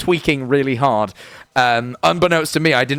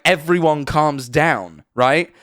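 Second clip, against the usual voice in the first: usual speaking voice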